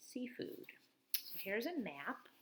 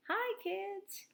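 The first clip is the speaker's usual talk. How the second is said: much higher cheerful hello; friendly greeting; 13 semitones higher than usual. Acted. Walker greeting the kids at the bus, high and cheerful. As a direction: greet them brightly.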